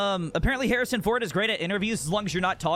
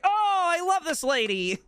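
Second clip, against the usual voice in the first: much higher, gleeful mock delight; suddenly thrilled old man; delighted squeal; 12 semitones higher than usual. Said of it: "he's like".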